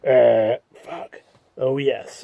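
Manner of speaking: ordinary delivery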